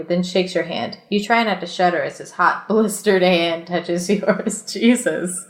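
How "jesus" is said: ordinary talk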